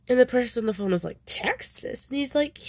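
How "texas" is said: high, incredulous mock query; disbelieving question. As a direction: imitate a puzzled caller, pitched way up. Phone listener in retold scene.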